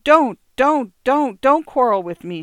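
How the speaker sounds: high, loud pleading wail; wife moaning to her husband not to quarrel; desperate moan. A narrator's reading. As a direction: beg him to stop, near tears. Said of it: "she moaned".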